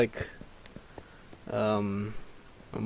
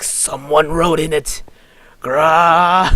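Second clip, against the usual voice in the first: raised raspy yell into a roar; Zane's deranged raspy shout; 5 semitones higher, 12 dB louder, slower than usual. Acted. Zane finding writing in his research, deranged raspy shout. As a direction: build from outrage to a raspy roar. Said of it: "Just do a raspy voice".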